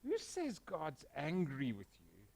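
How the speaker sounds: soft, slow, tender question; gentle reassurance. Real, not acted. Pastor reassuring a worried listener, soft and slow. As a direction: ease the listener's fear softly.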